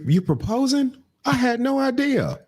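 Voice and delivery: high, hammed-up innocent surprise; fake surprise. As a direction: pretend you never knew, pitch way up. Family faking surprise.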